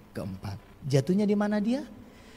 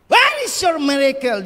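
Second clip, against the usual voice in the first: loud, high rallying question; loud challenge to the church; preacher's call; 13 semitones higher, 14 dB louder than usual. Acted. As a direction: fling the question at the crowd.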